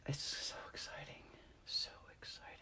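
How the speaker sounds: hushed, very soft whisper; whispered, barely above a breath; excited whisper. Real, not acted. Excitement about the coming trip.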